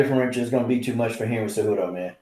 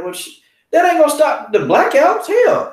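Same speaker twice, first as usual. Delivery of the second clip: high, loud exasperated complaint; indignant outburst; 4 dB louder than usual. Retold or imitated. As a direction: pitch it up in disbelief at the offer. Retelling angry phone complaint.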